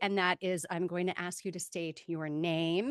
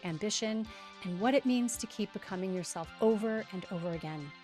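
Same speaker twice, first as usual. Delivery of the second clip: polished scripted host read; podcast intro read; 5 dB quieter than usual. Acted. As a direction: read the intro like a show opener. Scripted podcast intro over music.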